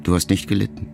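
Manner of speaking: plain reading voice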